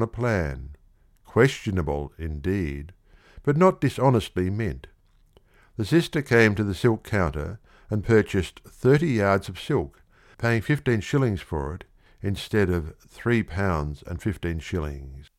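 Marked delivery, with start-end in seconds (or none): none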